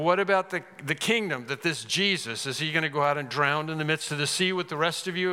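normal talking voice

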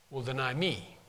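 will deny me, weight on me, dropping low and soft; stress on me. Shown as a demonstration. Preacher stressing Jesus' words, low firm stress on me. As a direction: land softly but firmly on me. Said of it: "the me is strong in that passage".

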